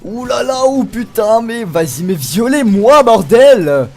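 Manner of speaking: loud, high furious outburst at the game; raging at the game. Real, not acted. Gamer losing a fight, furious loud shouting. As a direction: explode at the game as it beats you.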